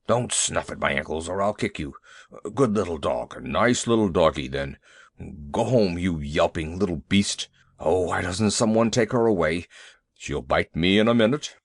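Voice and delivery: low, nervous big beast; a huge elephant pleading with a tiny dog; timid elephant voice. A narrator's reading. Elephant begging the little dog to leave, low and nervous.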